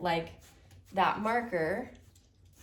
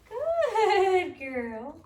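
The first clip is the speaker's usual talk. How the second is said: excited praise; 10 semitones higher, 8 dB louder than usual. Real, not acted. Trainer praising the dog during handling, excited and loud. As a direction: cheer the dog on, big and bright.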